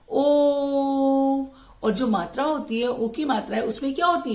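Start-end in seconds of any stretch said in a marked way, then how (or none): 0.1-1.4 ओ said long and rounded; o sound demo. Shown as a demonstration. Teacher modelling the ओ sound for kids. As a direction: model the vowel ओ. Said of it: "ओ की sound आ रही है".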